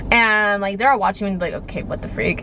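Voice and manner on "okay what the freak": whispered onlooker reaction. Retold or imitated.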